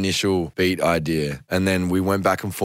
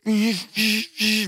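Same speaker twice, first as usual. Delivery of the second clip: high imitated wall-of-sound stabs; big synth sound; 13 semitones higher than usual. Shown as a demonstration. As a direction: mouth the big synth hits, pitched up. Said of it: "big Wall of Sound vibe".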